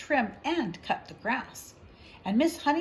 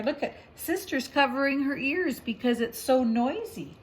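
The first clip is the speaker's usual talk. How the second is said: ordinary delivery, nothing marked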